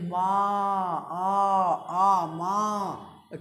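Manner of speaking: मा held long, its आ sounded alone; खींचकर उच्चारण. Shown as a demonstration. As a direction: draw out मा, आ, आ, मा slowly and evenly. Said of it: "सीमा को उच्चारण करो". (मा के भीतर का आ लंबा खींचकर)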